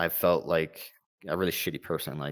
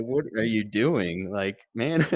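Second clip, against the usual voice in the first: higher, drawn-out incredulous question breaking into a laugh; laughing disbelief; 5 semitones higher, 4 dB louder, slower than usual. Retold or imitated. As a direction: retell your exasperated disbelief at a buddy, amused. Incredulous reaction to friend's active duty.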